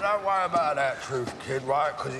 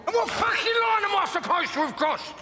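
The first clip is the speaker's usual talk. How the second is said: angry shout; 11 semitones higher than usual. Acted. Alfie shouts back at Tommy, outraged. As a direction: throw it back at him.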